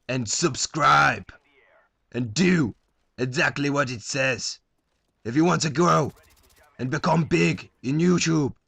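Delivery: lightly voiced Roman gladiator; booming defiant gladiator. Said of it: "do my Gladiator impression".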